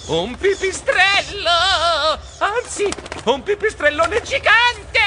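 high, drawn-out panicked scream; terrified shriek. A narrator's reading. Geronimo brushed by a bat, shrieking in terror. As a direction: shriek it, stretching the key words. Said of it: "strillai terrorizzato".

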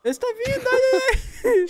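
high, drawn-out alarmed cry; alarmed warning. Real, not acted. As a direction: warn him they're coming, stretching it out. Jacarés se aproximando, alarme. (aviso assustado e agudo)

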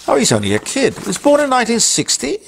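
high-pitched astonished question; incredulous. Real, not acted. Presenter reacting to Clarkson's birth year, incredulous. Mock disbelief at presenter's age.

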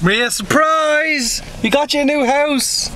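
high, slow grand reveal; excited announcement. Acted. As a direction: announce it like a gift. Mock present announcement.

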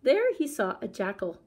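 ordinary delivery

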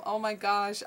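normal talking voice

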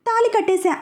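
high, amused boast; said laughing. Acted. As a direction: toss it off with a grin. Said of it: "అన్నాడు నవ్వుతూ". (నవ్వుతూ గొప్పగా)

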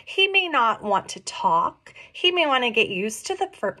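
usual speaking voice

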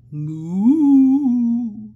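slow, loud drawn-out moo; loud slow cow call. Acted. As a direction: stretch it out like a lowing cow. Reading aloud, asking child to moo.

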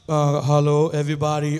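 low, loud put-on greeting; trying out a tone. Shown as a demonstration. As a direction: try the deep version of your preaching tone. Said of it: "how am I gonna sound, like".

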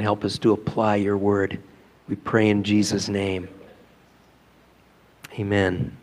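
hushed, unhurried prayer voice. Real, not acted. Pastor praying before the sermon, hushed and reverent.

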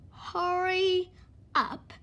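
drawn-out, loud hurry up; slow echo. Acted. Mister Slow answers Mister Busy, slowly drawn out. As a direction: drag the words out. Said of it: "replied Mister Slow".

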